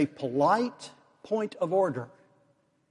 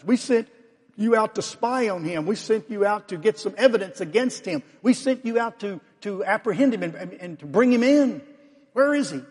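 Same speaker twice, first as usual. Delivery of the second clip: higher, rising exasperated demands, louder at the start; indignant scolding; 4 semitones higher, 3 dB louder than usual. Acted. Pharisees scolding the officers who came back without Jesus, exasperated and indignant. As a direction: berate them for coming back empty-handed.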